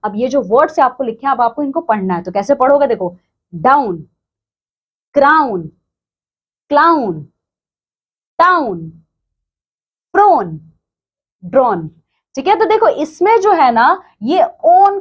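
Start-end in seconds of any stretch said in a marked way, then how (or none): 2.9-11.9 डाउन, क्राउन, क्लाउन, टाउन, प्रोन, ड्रॉन each said separately with a clear own sound; teacher modelling word list; reading words aloud. Shown as a demonstration. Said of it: "Say these words aloud".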